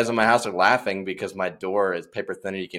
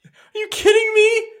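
laughing disbelief; 5 dB louder than usual. Real, not acted. Laughing outraged reply.

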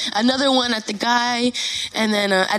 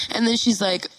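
ordinary delivery

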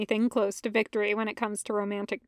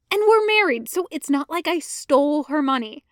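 defensive hiss; 4 semitones higher than usual. Acted. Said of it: "Anakin hisses".

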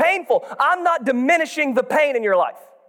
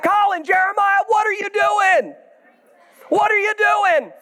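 put-on yelling at kids; 7 semitones higher than usual. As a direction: holler at the boys caught spraying. Dad yelling at sons in garage.

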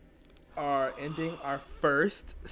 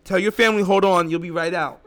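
louder, urgent instructions; emphatic command; 3 semitones higher, 9 dB louder than usual. Real, not acted. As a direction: tell listeners firmly to act. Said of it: "Stop what you're doing".

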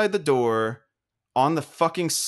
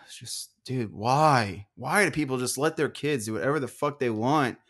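ordinary delivery

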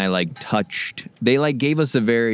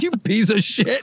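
mock dad; 10 semitones higher than usual. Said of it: "he was like".

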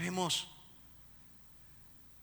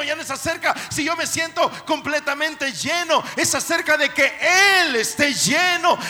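shouted, high preaching over music; preaching at full force; 8 semitones higher, 21 dB louder than usual. Acted. Preacher shouting to the congregation, full force. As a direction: preach it out loud and fervent.

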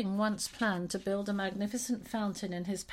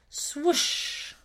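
breathy whooshing swoosh; airy swoosh effect; swoosh sound; 6 semitones higher, 4 dB louder than usual. A narrator's reading. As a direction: blow it out like wind rushing past.

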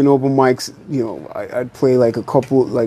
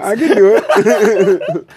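laughing; 6 semitones higher, much slower than usual. Real, not acted. Laughing reply to pushy host.

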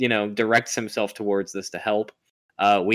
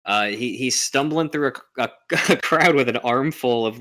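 ordinary talk

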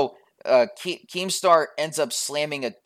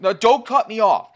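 loud, high insistence on not being interrupted; raised protest; 7 semitones higher, 7 dB louder than usual. Real, not acted. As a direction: push back hard, voice up high. Heated argument, cutting in.

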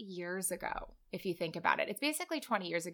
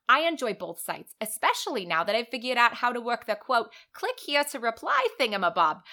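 loud, hammed-up Long Island accent; broad, put-on New York Long Island voice; 3 semitones higher, 5 dB louder than usual. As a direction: play an author's interview in a big Long Island accent. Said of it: "do my best to do this accent".

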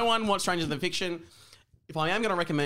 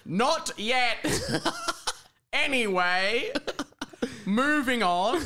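higher, emphatic announcement; all-caps emphatic; 7 semitones higher, much slower than usual. Acted. Narrator shutting the boy down, all-caps emphatic. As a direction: punch it out in capital letters. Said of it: "It's all in capital letters".

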